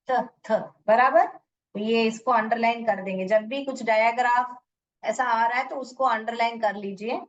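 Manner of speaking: ordinary delivery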